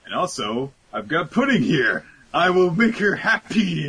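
high, loud, swooning voice; lovestruck Sanji voice. Retold or imitated. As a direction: gush like he's smitten. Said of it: "he's thinking".

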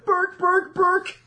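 high mock Muppet chef farewell; Swedish Chef sign-off. Signing off the fan email as the Swedish Chef, high and hammy. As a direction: end with a hammed-up burk burk. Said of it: "commitment to that".

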